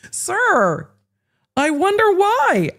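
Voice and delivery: very high, drawn-out mock wondering; sarcastic. Real, not acted. Commentator scoffs at the lawyer's hypocrisy, sarcastic. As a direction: needle him with a slow, soaring pitch. Sarcastic jab after laughing.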